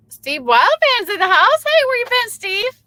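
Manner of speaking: high and loud, thrilled to see him; big shout-out to a regular viewer; excited welcome. Real, not acted.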